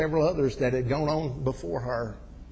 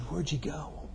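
hushed question; 4 semitones higher, 6 dB quieter, slower than usual. Retold or imitated.

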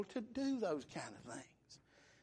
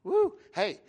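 ordinary talk

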